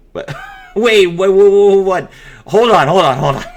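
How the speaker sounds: can't believe what you just read; stunned disbelief. Real, not acted. Presenter reading a broken card effect to viewers, loud stunned disbelief.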